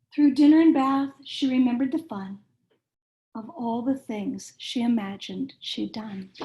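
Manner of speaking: lively picture-book read-aloud, rhyming bounce; reading to children. A narrator's reading. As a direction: read it like bedtime story time for little kids.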